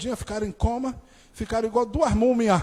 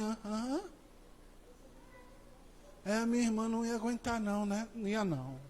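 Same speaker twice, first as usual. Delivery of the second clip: quiet agreement; 16 dB quieter, faster than usual. Retold or imitated. Injured sister quietly agreeing.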